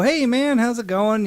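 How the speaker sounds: high, bright fake-friendly hello; chipper greeting. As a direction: play the overeager guy greeting fans. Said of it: "and I'd go".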